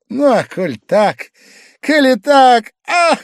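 high, loud, climbing to a cry; drunken outburst. A narrator's reading. Guest after the drink, loud, mounting exclamation. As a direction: build slowly to a high exclamation.

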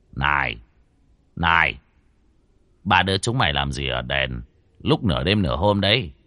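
a hint of a stern old man; old man calling out. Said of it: "cho nên cất tiếng hỏi".

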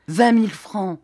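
"vingt mille francs" loud, stress on "vingt"; "vingt mille francs" said loud with a hard "vingt"; indignant repeat. A narrator's reading. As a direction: punch "vingt" in outrage. Repeats the sum twice, rising.

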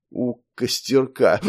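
put-on slow, slurred drunken mumbling; drunken mumble. A narrator's reading. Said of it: "промямлил дядя Стёпа".

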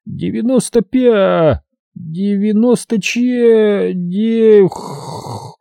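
высокий тягучий счёт, переходящий в тихое сопение; тяни числа всё медленнее и провались в сонный выдох; засыпает на счёте. A narrator's reading. Doctor falls asleep counting, into snore. (засыпает, слова тают в храпе)

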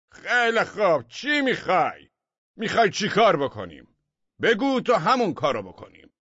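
high, loud weary shout; pleading crowd. A narrator's reading. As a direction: call up in defeat. Crowd calls up to roof.

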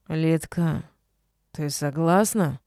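slow, slurred but stern; drunk slur. A narrator's reading. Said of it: "Заплетающимся языком, но строго спросил".

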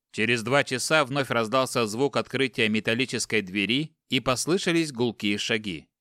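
usual speaking voice

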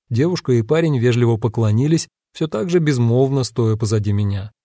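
plain reading voice

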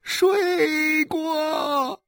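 drawn-out tearful admission; sobbing. Acted. Wife confesses to her husband, sobbing. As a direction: whimper it out through tears. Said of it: "许玉兰呜呜地说".

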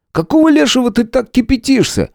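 high-pitched, worked-up protest; faltering, agitated. A narrator's reading. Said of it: "Сбивающимся тоном заговорил Шуляк". (взволнованно, сбивающимся тоном)

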